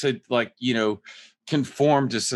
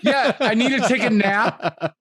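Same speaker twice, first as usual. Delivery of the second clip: laughing; 10 semitones higher, 5 dB louder than usual. Real, not acted. Still laughing.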